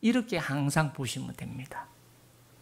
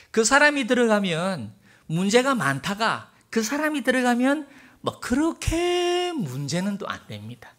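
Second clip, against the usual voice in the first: higher and louder, driving through the line; preaching louder; 7 semitones higher, 5 dB louder than usual. Shown as a demonstration. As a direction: preach it out, building force.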